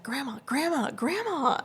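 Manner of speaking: high, sing-out mimic of a kid calling; imitated pestering call for Grandma; calling granddaughter.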